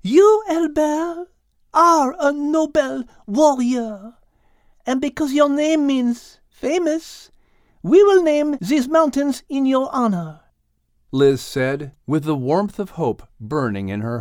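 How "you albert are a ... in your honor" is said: high, loud, delighted French lady; French-accented Liz. Acted. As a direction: gush with relief and thanks. Liz honoring Al.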